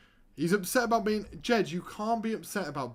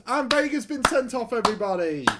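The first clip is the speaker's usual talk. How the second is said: much higher, louder outcry; exasperated announcement; 8 semitones higher, 8 dB louder, faster than usual. Real, not acted. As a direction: announce the red card in disbelief. Red card exasperation.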